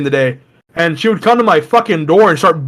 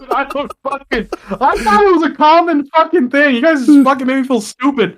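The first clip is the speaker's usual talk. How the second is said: high, laughing indignant whine; laughing protest; 9 semitones higher than usual. Real, not acted. Laughing at friends who made him feel stupid, high protest.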